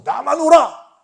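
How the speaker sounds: loud, high, emphatic claim; self-righteous shout. Acted. Preacher voices people who claimed they alone were right, self-righteous shout. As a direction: burst out high and loud, brooking no doubt.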